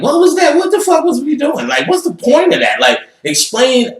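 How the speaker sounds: high, loud, demanding disbelief; incredulous. Retold or imitated. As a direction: fire off the questions, baffled and worked up. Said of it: "So I'm like".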